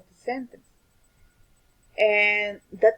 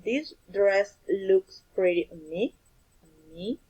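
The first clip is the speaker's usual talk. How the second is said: "me" swooping upward at the end; teacher's demo of a rise on "me"; rising intonation. Shown as a demonstration. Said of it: "use rising intonation".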